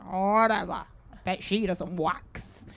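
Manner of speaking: snarky dismissal. Retold or imitated. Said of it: "And I was like".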